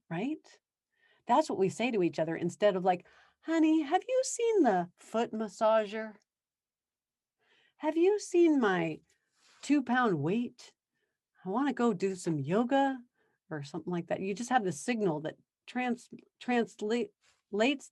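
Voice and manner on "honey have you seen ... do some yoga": sweetly casual. Retold or imitated. Sweet alternative to yelling spouse.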